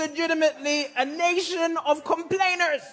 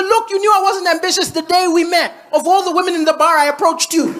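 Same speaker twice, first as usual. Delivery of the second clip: mock snap; 5 semitones higher, 10 dB louder than usual. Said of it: "I snapped".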